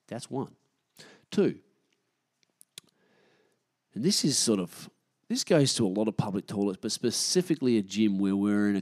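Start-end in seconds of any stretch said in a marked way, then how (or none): none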